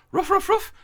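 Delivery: high yappy barks, imitating a dog; dog barking. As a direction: bark it out like Lassie. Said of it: "Lassie could go".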